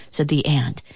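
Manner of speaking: ordinary delivery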